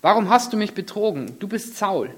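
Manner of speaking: high, loud accusing cry; the medium shrieks at the disguised king. Acted. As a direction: cry out in alarm, accusing him. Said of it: "schrie sie laut".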